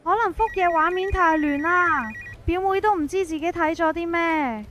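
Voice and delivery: plain reading voice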